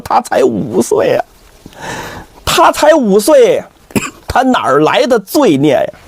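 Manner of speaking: drawn-out anguished plea, acted; 声嘶力竭. A narrator's reading. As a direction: wail it slowly in desperate protest. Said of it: "是声嘶力竭". (拖长声音，声嘶力竭地哀诉)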